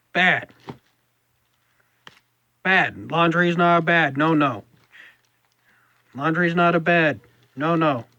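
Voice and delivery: loud, high scolding of a pet; scolding the cat. Real, not acted. Scolding the cat Sapphire off laundry.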